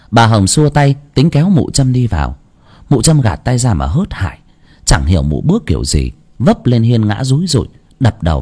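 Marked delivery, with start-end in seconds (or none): none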